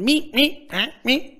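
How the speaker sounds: little high meeps; Beaker. Acted. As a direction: imitate small, odd puppet squeaks. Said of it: "other weird little meepy sound".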